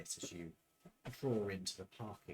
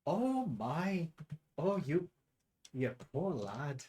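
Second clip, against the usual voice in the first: higher, slower motherly pity; pitying barmaid; 3 semitones higher, slower than usual. Acted. Barmaid pitying the young man, higher and slower. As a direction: coo over him sympathetically.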